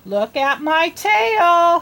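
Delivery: bunny voice. Acted. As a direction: show off your tail like a small proud bunny.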